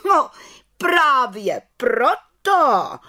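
very slow, high scolding wail; scold, dragging each word out. A narrator's reading. Head witch scolding the little witch, slow and high. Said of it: "hubovala vrchní čarodějnice".

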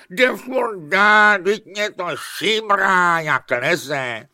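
high, loud, drawn-out wail; loud wailing whine. A narrator's reading. Princess complaining about her creeping nose, loud wail. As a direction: whine it high and long, easing at the end. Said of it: "uhňala".

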